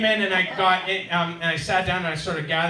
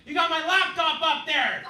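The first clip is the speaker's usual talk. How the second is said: high mock yell up to a window; imitated yelling; 12 semitones higher, 5 dB louder than usual. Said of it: "So it's like someone's yelling".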